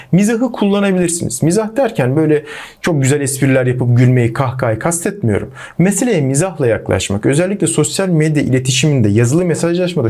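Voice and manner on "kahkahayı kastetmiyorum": normal talking voice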